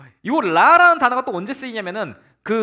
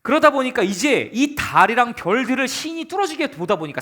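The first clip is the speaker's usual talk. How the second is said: ordinary talk, nothing marked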